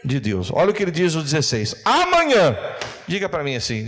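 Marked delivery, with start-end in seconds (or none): 1.8-2.6 high, loud drawn-out shout; bem alto. Shown as a demonstration. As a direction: shout it out for the congregation to echo. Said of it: "diga bem alto". (grito alto de pregador)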